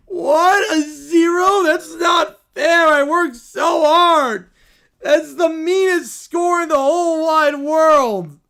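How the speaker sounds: put-on high, loud, slow wailing kid; mock crying child. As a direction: wail over the unfair score. Kid cries at score zero.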